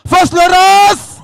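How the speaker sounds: high, slow, loud shout-out. Real, not acted. MC shouts out neighbourhoods to the audience, loud. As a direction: call the area out to the crowd.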